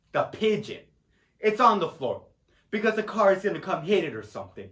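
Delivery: normal talking voice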